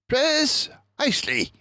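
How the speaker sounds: high, smug agreement; a detective pleased to be right; pleased Basil. Acted. Basil agrees with his friend, high and pleased.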